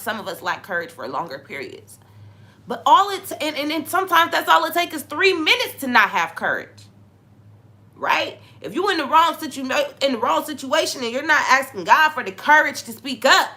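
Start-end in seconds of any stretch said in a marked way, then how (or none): 2.7-13.5 high, loud preaching climax; preaching at full force. Acted. Preacher urging listeners to ask God for courage, full force. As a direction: build it up and press the congregation.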